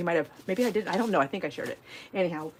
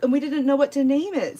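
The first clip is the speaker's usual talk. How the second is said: usual speaking voice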